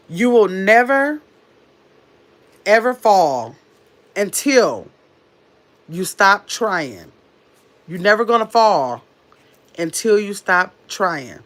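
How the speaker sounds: slow, measured inspirational read; affirmation reading. Acted. Reading her little sister's affirmation slowly and earnestly. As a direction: lay each word down like a pep talk.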